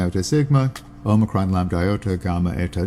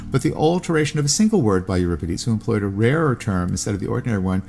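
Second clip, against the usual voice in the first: ordinary talk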